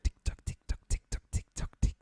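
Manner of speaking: whispered tick-tock clock sounds; tick tock clicks. Pretend. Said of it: "Keep that going".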